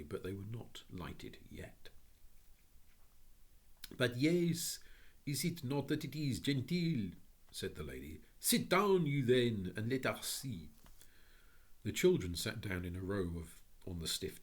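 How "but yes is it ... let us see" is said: put-on high, French-accented lady's welcome. A narrator's reading. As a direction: greet them in a lilting French accent. French lady speaks.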